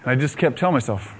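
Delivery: usual speaking voice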